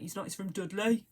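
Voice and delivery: mock Dudley accent. Correcting hubby about Lenny Henry, mock Dudley accent. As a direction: put on a light Dudley accent. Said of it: "I always do the whole".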